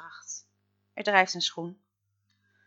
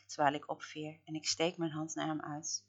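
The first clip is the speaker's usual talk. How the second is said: ordinary delivery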